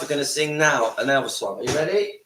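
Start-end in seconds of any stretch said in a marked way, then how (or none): none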